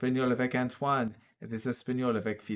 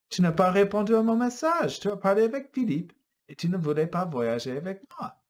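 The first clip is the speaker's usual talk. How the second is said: accusing; 9 semitones higher, 6 dB louder than usual. Acted. Accusing lover in story.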